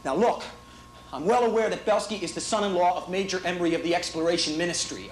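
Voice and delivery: ordinary delivery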